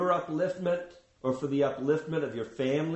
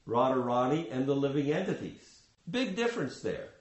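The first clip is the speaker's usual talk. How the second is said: ordinary delivery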